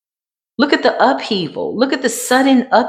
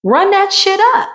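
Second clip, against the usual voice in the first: gleeful, much higher dare; hyped dare; 12 semitones higher than usual. Retold or imitated. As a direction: voice the universe saying bring it on. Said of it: "the universe said".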